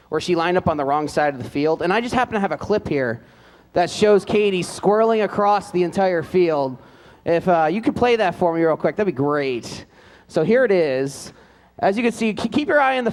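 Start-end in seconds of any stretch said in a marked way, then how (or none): none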